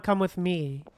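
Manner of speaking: ordinary talk, nothing marked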